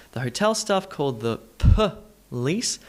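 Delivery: police split as puh-LEECE; schwa demonstration. Shown as a demonstration. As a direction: isolate the uh before LEECE in police. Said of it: "add in the all-important schwa".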